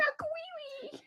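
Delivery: high, soft squeaky cry; silly high voice. Acted. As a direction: chirp it high and quiet. Repeating silly catchphrase.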